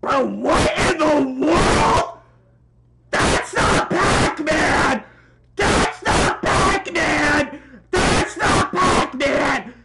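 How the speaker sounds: loud, slow, drawn-out yell; disbelieving shout. Real, not acted. Reactor refusing to accept Pac-Man's voice, drawn-out shout. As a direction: holler each word out in disbelief.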